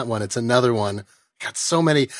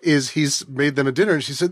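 ordinary delivery

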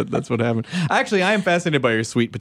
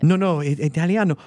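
normal talking voice

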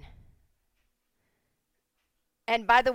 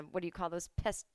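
ordinary delivery

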